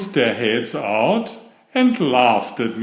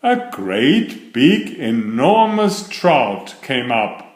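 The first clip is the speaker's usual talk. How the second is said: usual speaking voice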